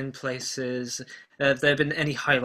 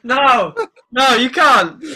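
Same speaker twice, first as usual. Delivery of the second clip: laughing protest; 11 semitones higher, 13 dB louder, slower than usual. Real, not acted.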